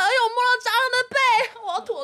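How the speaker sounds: revolted shriek about touching a roach; disgusted squeal. Retold or imitated. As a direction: rattle it off shuddering with disgust.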